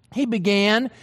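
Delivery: ordinary delivery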